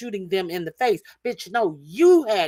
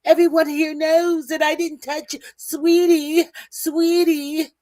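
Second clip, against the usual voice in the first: high, mock-sweet imitation of the woman, louder; 7 semitones higher, 3 dB louder than usual. Man mocking the woman he confronted, high sugary fake voice. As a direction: put on a sugary fake-innocent voice to mock her. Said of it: "mocking the woman's voice".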